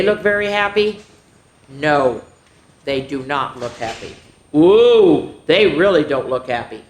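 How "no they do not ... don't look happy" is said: ordinary delivery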